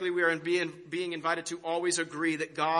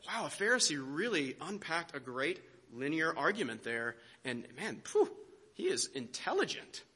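hushed, put-on awe, softer; mock marveling; 3 semitones lower, 7 dB quieter than usual. Jesus supposedly admiring a Pharisee's linear argument, mock marveling. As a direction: act quietly bowled over by someone's cleverness. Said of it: "where it says Jesus marveled".

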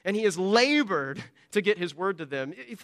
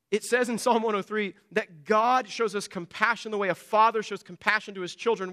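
ordinary talk

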